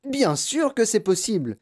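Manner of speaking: high, emphatic insistence; excited grandfather's high retort; exclaiming. A narrator's reading. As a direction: cry it out as obvious. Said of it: "s'écria grand-papa Joe".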